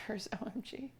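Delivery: whisper. Real, not acted. Whispered aside while baking.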